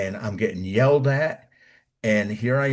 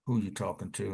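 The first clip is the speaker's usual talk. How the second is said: softened sergeant; 4 semitones lower, 10 dB quieter than usual. Retold or imitated. Sergeant suddenly gentle.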